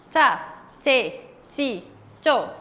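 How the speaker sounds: "Cha, che, chi, cho" as separate crisp ch syllables; sonido CH. Shown as a demonstration. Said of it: "cómo va a sonar la C H". ("Cha, che, chi, cho" separadas, marcando la CH)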